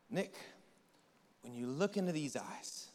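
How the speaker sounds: soft, fading intimate voice; tender Jesus. Retold or imitated. Jesus speaking softly to Nick, tender and hushed. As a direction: draw him in gently.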